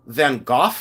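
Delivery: Gogh said as "goff", questioning; viewer's pronunciation. Retold or imitated. Seller testing a viewer's version, Gogh as "goff". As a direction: try Gogh as "goff", rising. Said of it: "You say Van Gogh?".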